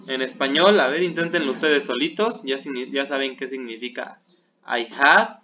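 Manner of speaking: ordinary delivery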